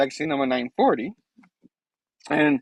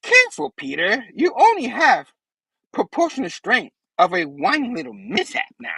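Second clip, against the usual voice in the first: condescending villain lecture, pitched up; Green Goblin voice; 7 semitones higher than usual. Retold or imitated. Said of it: "Goblin's like".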